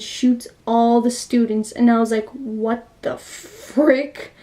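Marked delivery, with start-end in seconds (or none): 2.3-4.3 drawn-out, slow disbelief; stunned disbelief. Retold or imitated. Viewer reacting to the teacher shooting students, stunned disbelief. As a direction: stretch the words out, dumbfounded.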